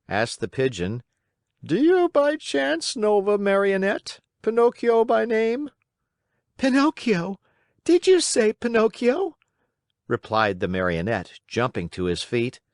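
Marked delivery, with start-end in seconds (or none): 1.6-5.7 high-pitched storybook bird voice; inquiring pigeon. Acted. Pigeon asks the boy after a Marionette, high inquiring voice. 6.6-9.4 high-pitched, astonished puppet; startled Pinocchio. Acted. Pinocchio hears the pigeon name him, high startled voice.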